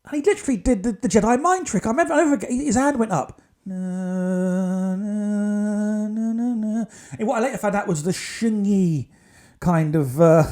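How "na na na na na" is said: soothing hum. Retold or imitated. Healer's calming Jedi gesture.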